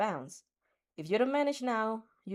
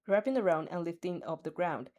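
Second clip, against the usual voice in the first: usual speaking voice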